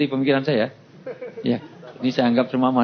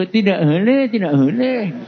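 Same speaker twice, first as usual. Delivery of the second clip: high, stretched-out singsong refusal; refusing vendor; 6 semitones higher, 4 dB louder, slower than usual. Acted. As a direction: turn down the bargain in a raised seller's voice. Vendor refuses the low offer.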